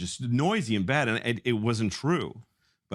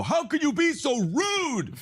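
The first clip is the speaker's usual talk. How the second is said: mock screaming at customers. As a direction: play the comic lashing out at polite drinkers. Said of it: "He'd just start screaming at them".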